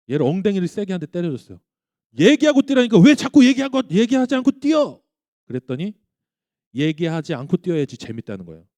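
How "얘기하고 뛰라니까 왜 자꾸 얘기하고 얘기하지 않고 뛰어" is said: high, loud exasperated scolding; angry scolding. Retold or imitated. Dad scolding his jumping son, angry and loud. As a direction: raise your voice at a disobedient child. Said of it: "너무 화가 나서".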